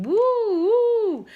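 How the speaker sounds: high, loud siren imitation; siren wail. A narrator's reading. Narrator mimics arriving ambulance, high siren wail. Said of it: "a siren started wailing".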